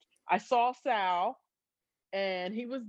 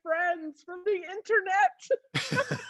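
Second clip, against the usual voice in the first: high, giggling confession; high-pitched laughing admission; laughing sheepishly; 10 semitones higher than usual. Retold or imitated. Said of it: "I'm like".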